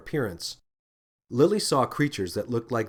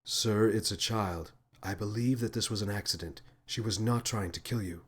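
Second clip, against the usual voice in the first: lower, quiet, calm creature voice; soft and gentle; 3 semitones lower, 6 dB quieter than usual. A narrator's reading. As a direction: gently reassure the angry man. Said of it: "in a surprisingly soft and gentle voice".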